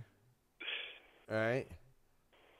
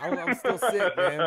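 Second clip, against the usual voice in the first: pitched-up whiny imitation of a sick guy, louder; lightly mock the guy claiming he's still sick; mock sick excuse; 4 semitones higher, 7 dB louder than usual. Mocking the co-host who dodged the challenge, whiny put-on sick voice. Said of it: "That motherfucker said he's still sick".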